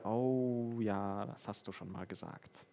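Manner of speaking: sheepish admission. Retold or imitated. His own sheepish reply.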